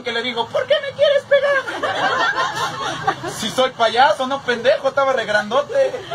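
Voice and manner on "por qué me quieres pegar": high whiny clown squeal, close to tears; silly clown. Retold or imitated. (payaso chillón con voz muy aguda)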